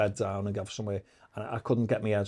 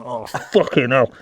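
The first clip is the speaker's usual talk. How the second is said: loud, drawn-out oh no; retold dismayed realisation; 9 dB louder, slower than usual. Prisoner learning he has insulted the governor, loud drawn-out dismay. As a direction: groan it out slowly and loudly.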